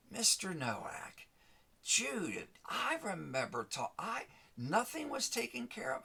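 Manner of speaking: a hint of a surprised customer service lady; phone lady's voice.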